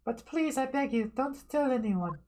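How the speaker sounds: put-on Russian accent. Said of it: "a Russian female voice".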